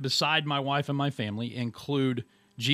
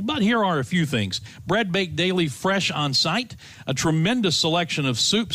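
louder, upbeat commercial read; radio ad read; 2 semitones higher, 5 dB louder than usual. Acted. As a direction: sell it like a radio spot. Host reads his own ad.

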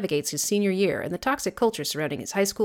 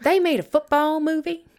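put-on Southern accent; 9 semitones higher than usual. Reacting to co-host's movie mention, mock Southern accent. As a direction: feign astonishment in a hammy Southern accent. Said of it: "your Southern accent, just, just like".

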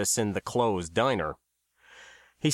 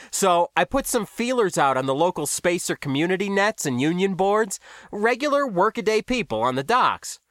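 higher-pitched, self-satisfied explainer; smiling New Guy; 6 semitones higher than usual. A narrator's reading. New Guy tells how he found the gossip, smiling and higher-pitched. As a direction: explain it knowingly through a smile.

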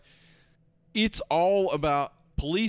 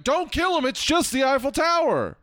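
high, loud frantic plea; Morgan Freeman yelling; 11 semitones higher, 4 dB louder than usual. Retold or imitated. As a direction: yell it as if to stop a shooting. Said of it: "Morgan Freeman was running over to be like".

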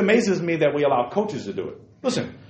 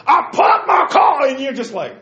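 high, loud, slow mock yelling; hammed-up TV pundit rant; Stephen A. Smith yelling; 12 semitones higher, 6 dB louder, slower than usual. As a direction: bellow a trivial story like a sports pundit. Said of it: "he'd be yelling about it".

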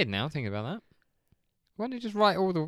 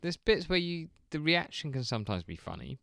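usual speaking voice

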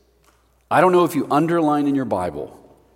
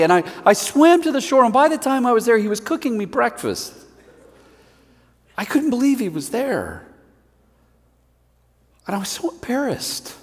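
Peter remembering; 9 semitones higher than usual. Acted. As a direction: recall the beach with wonder. Peter continues his story.

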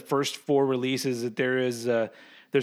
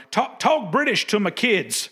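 higher-pitched mock American accent; imitating American guy; 7 semitones higher, 3 dB louder than usual. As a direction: imitate an American dad from a comedy bit. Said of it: "the impression of the guy going".

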